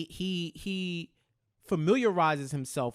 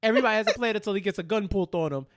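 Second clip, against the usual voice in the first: ordinary talk, nothing marked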